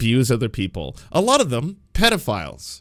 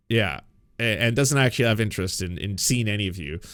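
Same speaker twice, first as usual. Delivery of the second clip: ordinary talk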